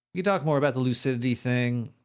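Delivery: ordinary talk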